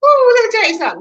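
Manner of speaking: loud, high exclamation; emphatic ooh. Real, not acted. Telling viewers about husband's forgotten ID, loud and high. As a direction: let the exasperation burst out.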